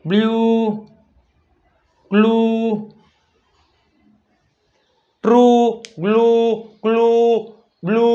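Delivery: chant-like model of ટ્રુ, બ્લુ, ગ્લુ, each vowel stretched; long u vowel. Shown as a demonstration. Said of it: "લાંબો સ્વર આવે છે". (ટ્રુ, બ્લુ, ગ્લુમાં લાંબો ઉ)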